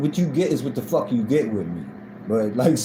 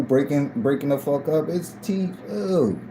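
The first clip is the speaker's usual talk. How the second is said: normal talking voice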